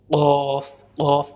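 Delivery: क़ाफ़ popped loud from deep in the throat; deep throat qaf. Shown as a demonstration. Teacher showing students a loud throaty क़ाफ़. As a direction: hit क़ाफ़ hard from the back of the throat. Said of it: "हलक से निकलता है".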